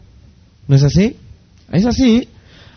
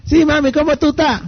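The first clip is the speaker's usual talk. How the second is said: put-on higher, lilting Caribbean Spanish accent to her mom; 8 semitones higher than usual. Wife answers her mother's call in a Caribbean Spanish accent. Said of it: "Entra una llamada de su mamá".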